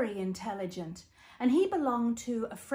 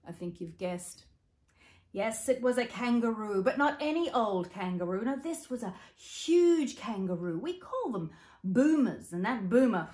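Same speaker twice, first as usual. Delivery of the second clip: ordinary delivery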